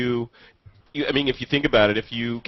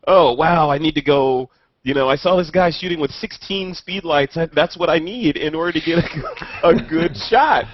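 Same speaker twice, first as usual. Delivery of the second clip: louder, higher gushing about speed lights, laughing at the end; mock excited gushing; 5 semitones higher, 4 dB louder than usual. Imitating photographers who think they need more gear, mock excited gushing. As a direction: ham up a gear-obsessed amateur, with a laugh.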